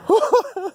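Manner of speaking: short high-pitched laugh; high giggle. Real, not acted. Seeing it's still going, a high giggle. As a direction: giggle in giddy disbelief.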